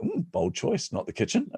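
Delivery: high-pitched "ooh" of feigned approval; playful, high-voiced aside; mock impressed. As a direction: jump up high, as if weighing a daring choice.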